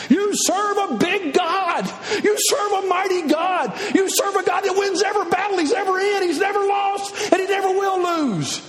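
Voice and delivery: preaching at full force. Acted. Preacher rallying the congregation, shouting. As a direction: thunder it to the crowd.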